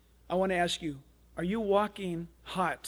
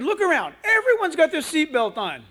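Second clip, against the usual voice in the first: high, loud pleading scold; raised-voice parental reasoning; exasperated mother; 9 semitones higher, 9 dB louder than usual. Retold or imitated. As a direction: imitate a mom arguing at full voice. Mother argues with boy, retold.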